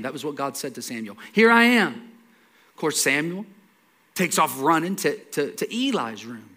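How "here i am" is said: high, loud summons; big projected call. Retold or imitated. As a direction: call it out like God's voice. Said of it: "God calls out to him".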